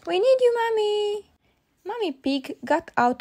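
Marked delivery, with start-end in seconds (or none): 0.1-1.3 high calling voice. A narrator's reading. Peppa calling Mommy for help, high call.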